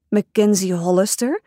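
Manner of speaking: ordinary delivery, nothing marked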